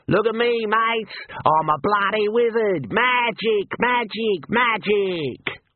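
the bandit mocking wizardry for his mates; mocking showman. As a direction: ham it up to jeer at the wizard. Emu rider mocking wizardry.

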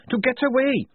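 high, loud, furious rant; heated. A narrator's reading. Tonka red in the face over bullies, ranting. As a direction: pour it out angrily, pitch way up. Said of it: "red in the face".

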